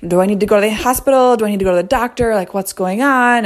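fast, high, retold panic; frantic questions to her husband, replayed panic; panic mode. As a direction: rattle the questions off in mock panic. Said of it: "automatic panic mode".

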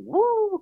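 high, loud "woo"; sudden high siren noise; imitated police siren. Imitating arrival of the cops.

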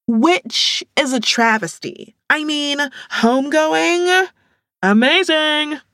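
high, loud theatrical gush; dramatic. A narrator's reading. Latoya protesting the book rules to friends, dramatic. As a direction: ham up the outrage. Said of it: "held her arms out dramatically".

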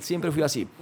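ordinary delivery

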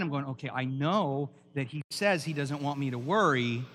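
usual speaking voice

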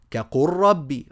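doubled r joins كقل to ربي; verse example recited. Shown as a demonstration. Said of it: "ثم قال". ("كقل ربي" بإدغام اللام في الراء)

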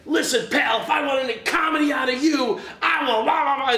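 high, loud mock drunken shout; drunk yelling at me. Drunk jabbing finger at the singer, hammed-up angry shout. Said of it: "and he's like".

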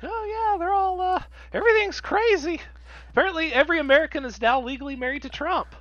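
high, fast mock news bombshell; mock breathless gossip. To friend back from holiday, high fast mock gossip. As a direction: blurt the fake scandal in a high squeal.

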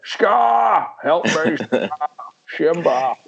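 high Scottish cry for help, S as SH; hammed-up Scottish Mufasa in peril; Sean Connery. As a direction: call out desperately in a mock Scottish accent. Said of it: "to do the voice".

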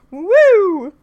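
high, loud whoop; loud high woo; mock cheer. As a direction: whoop like a fan.

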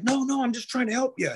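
hammed-up pleading from the guy who stopped; imitating pleading Big Greg. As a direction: squeak it out like a scared helper.

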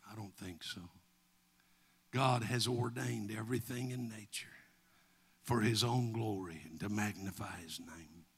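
low, softened, solemn delivery; hushed preaching. Real, not acted. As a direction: drop the voice and let it sink in. Preacher drops to solemn aside.

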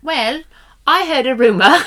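high, loud mock British accent; put-on British accent. Co-host teasing a London rumor, put-on British accent. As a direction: announce it in a playful fake London accent. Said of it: "I'm gonna just cancel that".

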